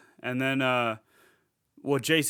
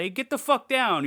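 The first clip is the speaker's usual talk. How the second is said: high, loud mock yell; hammed-up furious neighbour shouting down at someone; angry car owner; 11 semitones higher, 4 dB louder than usual. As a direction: shout him off the car in a put-on voice. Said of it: "he's like".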